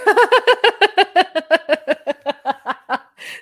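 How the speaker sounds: long high burst of real laughter; a real fit of loud laughter; loud laughing fit. Real, not acted. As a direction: crack up helplessly.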